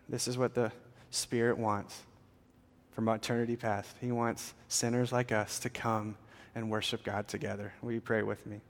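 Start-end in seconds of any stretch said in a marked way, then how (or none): none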